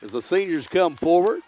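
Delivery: booming sportscaster call over the crowd; loud play-by-play. Acted. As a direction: announce it big and loud, like live radio.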